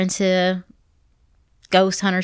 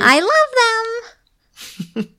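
high, long drawn-out squeal of adoration; squealed, slow declaration of love; gushing; much slower than usual. Real, not acted. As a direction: stretch it out high and gushy. Gushes about loving serial killers.

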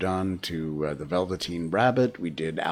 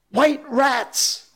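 put-on very high, excited child's cry; inspired cry; 4 dB louder than usual. A narrator's reading. Nib guessing what the Darlings had, inspired cry. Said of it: "cried Nib, inspired".

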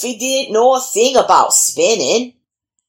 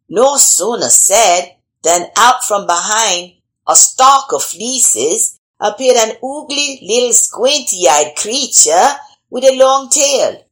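ordinary delivery

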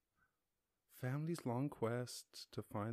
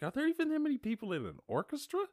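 much higher and fast, mock disbelief; incredulous; faster than usual. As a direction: rattle it off in a high, amazed voice. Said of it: "I was like".

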